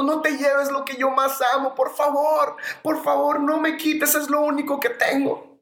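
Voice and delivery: heartbroken pleading. Retold or imitated. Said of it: "gritaba desesperada, con una voz desgarradora".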